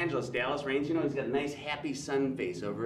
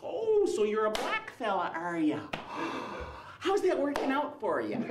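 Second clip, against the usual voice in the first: high, drawn-out Fargo accent, mock-curious; cuddly Fargo local with a singsong Midwestern lilt; high-pitched small-town Fargo accent; 8 semitones higher than usual. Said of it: "little cuddly people from the movie Fargo".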